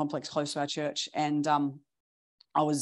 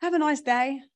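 cheery comeback; 10 semitones higher, 4 dB louder than usual. Retold or imitated. Cheery comeback to ranting man.

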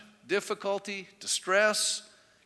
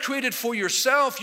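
ordinary talk

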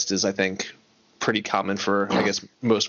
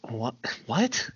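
baffled reaction; 2 semitones higher, 6 dB quieter than usual. Retold or imitated. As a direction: re-enact quiet bafflement rising into disbelief. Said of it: "that was me by myself, just like".

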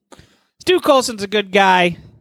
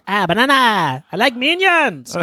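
minion voice; 7 semitones higher than usual. Pretend. Imitating a minion.

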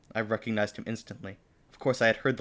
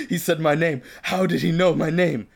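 high, loud fangirl gush; hammed-up starstruck swoon; 6 semitones higher, 7 dB louder than usual. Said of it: "I swooned".

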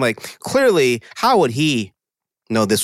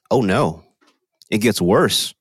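normal talking voice

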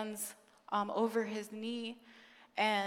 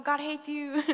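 mock picket preacher; 5 semitones higher than usual. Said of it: "like, hold the picket".

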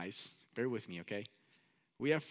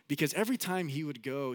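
ordinary delivery

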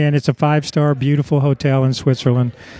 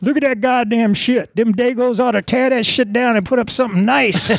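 high put-on voice, holding forth; high-pitched mock grumbling pronouncement; imitated cranky tour guide; 8 semitones higher than usual. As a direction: imitate a grouchy man playing tour guide. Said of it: "trying to be the tour guide".

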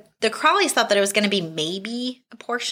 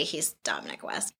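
hushed unvoiced whisper, much softer; breathy swoon whisper; 13 semitones lower, 14 dB quieter than usual. Real, not acted. Fan swooning over Dominic West, whispered. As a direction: whisper it like a guilty crush. Swooning aside about the actor.

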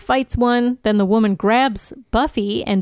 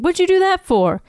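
Spike line; 9 semitones higher than usual. A narrator's reading.

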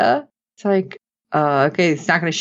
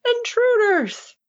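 very high, slow alarm call; yelling; slower than usual. Retold or imitated. Fleeing villain shouting to warn his troops, very high. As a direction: raise the alarm while running off. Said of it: "As Zod runs off yelling".

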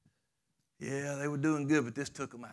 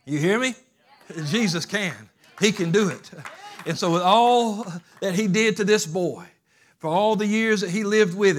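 loud, driving preacher's cadence; loud revival preaching; full-force preaching; 4 semitones higher, 8 dB louder than usual. Acted. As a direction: preach it hard to the congregation. Preaching climax.

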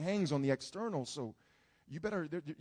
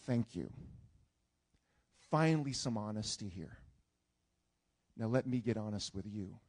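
calm God voice; 7 semitones lower than usual. Acted. God's reply, calm and low.

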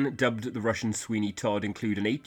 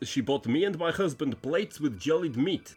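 light mock Russian accent, higher; reading a quote in a playful Russian accent; 6 semitones higher than usual. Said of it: "Would you like me to do the accent?".

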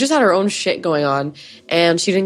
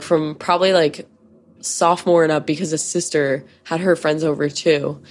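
ordinary talk, nothing marked

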